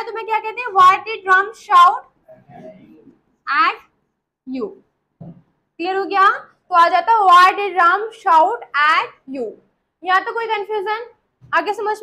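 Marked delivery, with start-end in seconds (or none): 0.6-4.7 very slow, with long pauses before the last words; halting dictation for the class; slow drill model. Shown as a demonstration. Models sentence in separated pieces. 7.1-9.5 very slow, evenly spaced words; measured teacher dictation; slow drill model. Shown as a demonstration. Repeats model sentence for class.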